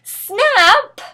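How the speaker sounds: very high, loud shriek; scream. Acted. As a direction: shriek out of the window.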